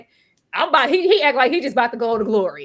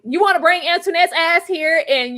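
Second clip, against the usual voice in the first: ordinary delivery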